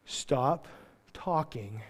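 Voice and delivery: ordinary talk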